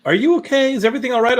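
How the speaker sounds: high-pitched, solicitous check-in; imitated concern. Imitating friend checking on grumbly man, concerned.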